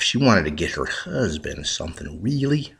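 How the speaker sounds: usual speaking voice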